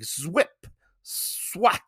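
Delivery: quick mouth-made slash noises; sharp comic-book swish effects; imitated sword swishes. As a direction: make the sound of a blade cutting air.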